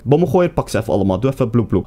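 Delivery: usual speaking voice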